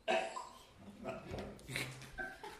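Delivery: airy laugh after a joke; high breathy laugh. Real, not acted. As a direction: laugh it off lightly. Joke about a beard.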